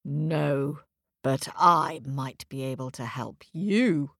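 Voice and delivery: low, smooth gentle bear; bear voice like chocolate. A narrator's reading. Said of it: "said the bear in a voice like chocolate".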